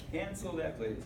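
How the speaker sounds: usual speaking voice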